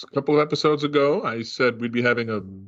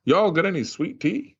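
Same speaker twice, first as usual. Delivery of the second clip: put-on light Georgia accent, higher pitch; imitating a Georgia friend at lunch, mild Southern accent; Southern Georgia drawl; 5 semitones higher than usual. Host retells colleague ordering at lunch, lightly imitated Georgia accent. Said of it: "I know he was from Georgia".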